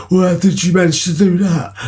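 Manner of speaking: high, incredulous questioning voice; astonished friend imitation. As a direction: mimic a mate baffled by the feat.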